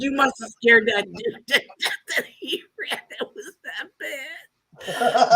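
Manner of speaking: real laughter breaking into the words; cracking up mid-sentence; laughing while talking. Real, not acted. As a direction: struggle to finish the story through laughs. Teller cracks up at own story.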